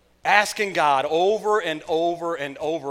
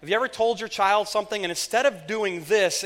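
ordinary talk, nothing marked

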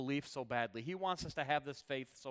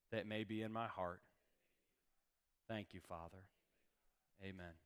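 measured prayer, growing softer and lower; earnest prayer; 3 semitones lower, 4 dB quieter than usual. Real, not acted. Preacher praying with eyes closed, hushed and earnest. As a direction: lead them gently in prayer, with long pauses.

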